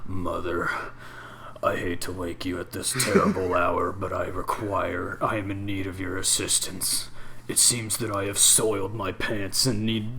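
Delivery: super low monotone voice. Retold or imitated. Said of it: "it's like a super low monotone voice".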